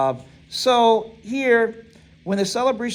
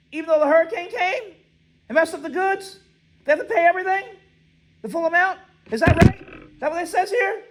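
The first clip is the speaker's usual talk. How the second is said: higher, incredulous questions that keep rising; mock disbelief; 9 semitones higher, faster than usual. Teacher feigning shock at the law for students. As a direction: act shocked so the class re-checks the rule.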